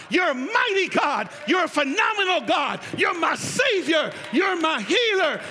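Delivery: shouted, high-pitched praise in driving phrases; impassioned preacher shouting worship; full-force preaching. Real, not acted. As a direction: cry out each declaration of praise with full fervor.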